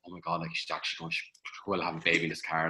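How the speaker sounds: hushed, low aside to himself; retold private panic kept under the breath; inner thought. Husband driving his labouring wife, hushed low inner thought. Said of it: "I went into my head".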